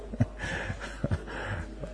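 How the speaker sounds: breathy laugh. Real, not acted. Laughs at own joke.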